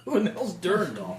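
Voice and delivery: baffled reaction. Acted. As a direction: act out a group's bafflement at a strange name. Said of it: "And you guys are like".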